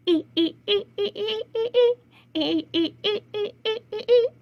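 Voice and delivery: high rhythmic creaks; creaky hinge singing along; rusty hinge. Acted. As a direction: squeak like a rusty barn door. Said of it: "Ever hear a rusty hinge on a barn door?".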